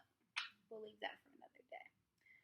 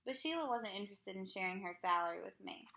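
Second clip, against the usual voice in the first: ordinary delivery, nothing marked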